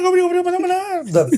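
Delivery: slow, drawn-out radio announcer voice; Spanish radio voice. Shown as a demonstration. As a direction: stretch it like a Latin radio host. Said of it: "I used to be able to do that".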